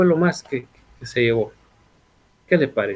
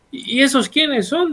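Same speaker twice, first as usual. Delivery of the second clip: higher, mock puzzled student question; 8 semitones higher than usual. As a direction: play the class asking, lightly puzzled. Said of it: "dirán ustedes".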